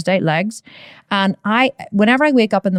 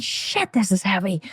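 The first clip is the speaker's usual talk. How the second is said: breathless whispered curse under a heavy bar; strained whisper under the bar; 7 dB quieter than usual. Retold or imitated. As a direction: hiss it out as the weight lands.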